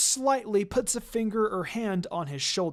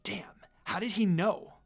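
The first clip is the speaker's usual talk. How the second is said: breathy unvoiced whisper to herself; whispered thought. Minako wonders to herself in a whisper. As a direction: hiss it under your breath.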